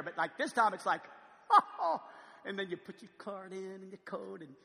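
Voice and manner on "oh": relieved oh. Retold or imitated. Relief at the gas station.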